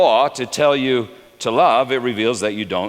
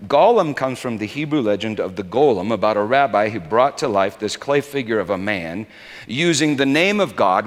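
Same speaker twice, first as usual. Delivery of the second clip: ordinary talk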